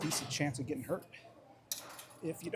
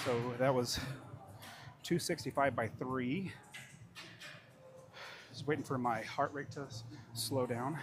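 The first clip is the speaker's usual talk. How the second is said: usual speaking voice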